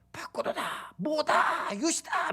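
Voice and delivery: high, hushed imitation of excited shouts; squealed cheers with the volume held back; mock delighted cheering. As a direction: act out a family thrilled by a board game win. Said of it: "막 이러면서 되게 좋아합니다".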